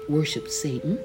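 plain reading voice

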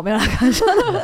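laughing it off after a joke. Real, not acted. Telling friends she was only joking, laughing. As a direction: chuckle through the retraction. Jokes about public shaming.

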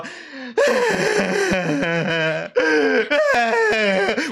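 high fake crying wail; mock wailing cry. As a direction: pretend to bawl at the plane. 示范要哭给他们听. (高声假哭嚎)

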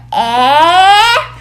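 边笑边拖长的えー; 委屈又好笑地叫出えー; laughing dismayed eh. Acted. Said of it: "そんなのひどい". (带笑的えー)

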